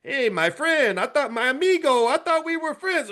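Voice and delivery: Mexico pleading with Trump in a high voice; Mexico as friend. Retold or imitated. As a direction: sound like a betrayed friend. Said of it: "Mexico's like".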